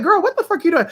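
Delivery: high, incredulous outcry; shocked. Retold or imitated. Retelling her shock at the friend's gifts, high incredulous. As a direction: blurt it out in disbelief.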